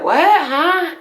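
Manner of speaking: high, loud, startled dad on the phone; Miami dad. Retold or imitated. Miami dad answering his daughter's call, loud and startled. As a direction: imitate a father's loud, baffled reply.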